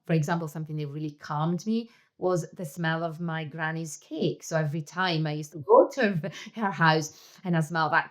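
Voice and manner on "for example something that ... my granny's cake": ordinary talk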